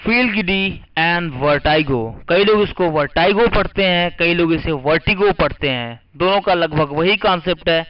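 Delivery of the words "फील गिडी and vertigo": drawn-out phrase; slow dictation. Shown as a demonstration. Teacher announcing the vocabulary pair, slowly. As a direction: say it slowly for copying. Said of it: "पहले लिख लीजिए".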